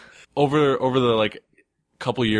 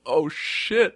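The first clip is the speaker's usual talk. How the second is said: higher, awed swear; retold emotional reaction; 7 semitones higher than usual. Writer retelling reaction to a designer's support, emotional. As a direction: re-enact being moved and taken aback. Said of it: "I got emotional. I was like".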